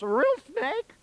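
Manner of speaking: loud, very high, strained question; comic high-pitched panic; mock panicked cry. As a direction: imitate a terrified patient yelping. Retold panicked patient identifying snakes.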